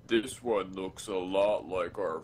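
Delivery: dragged out, very slow; slow motion voice. Shown as a demonstration. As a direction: stretch every word like slow motion. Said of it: "a really slow motion voice".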